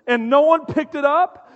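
usual speaking voice

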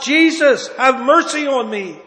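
loud pleading cry. Acted. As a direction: call out for mercy. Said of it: "And he cries out".

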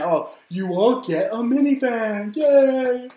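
high, loud gushing host voice; hammed-up talk-show host squealing a prize; mock Oprah giveaway. Oprah to her studio audience, a hammed-up high squeal. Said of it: "that's how she used to entice".

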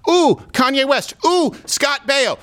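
high, swooning ooh over celebrities; fawning fans. Retold or imitated. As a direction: gush like starstruck fans. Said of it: "And so they go".

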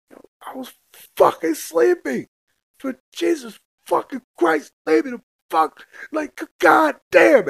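high, loud, furious yelling; woken-up furious yelling. Retold or imitated. Man yelling at his baby mama after she hits him, furious. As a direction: yell it like you've just been hit awake. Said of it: "I was like".

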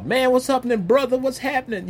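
high-pitched greeting from a buddy; imitating friendly callers. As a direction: greet a brother as a caller would. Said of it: "sending emails and".

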